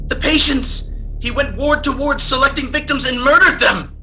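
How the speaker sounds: frantic. Acted. Edgar frantically recounts the murders.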